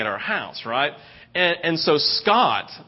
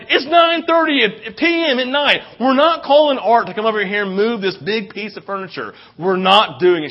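loud, high-pitched mock refusal; hammed-up husband's objection at high pitch; exasperated protest; 5 semitones higher, 6 dB louder than usual. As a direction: put your foot down, loudly and incredulously. Acted late-night protest to his wife.

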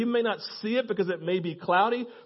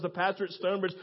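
ordinary talk

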